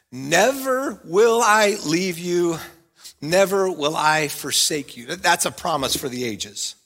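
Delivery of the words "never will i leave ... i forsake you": very slow, each word weighed; slow solemn. Shown as a demonstration. Pastor reads God's promise to church, very slow. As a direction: let God's promise land slowly. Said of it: "listen carefully to it".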